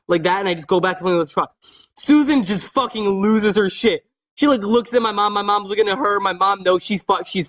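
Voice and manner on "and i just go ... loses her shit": ordinary talk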